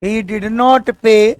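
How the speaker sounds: very slow, word by word; slow dictation. Shown as a demonstration. Teacher dictating to the class, very slow. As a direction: dictate it slowly for students to write. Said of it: "teacher dictates slowly".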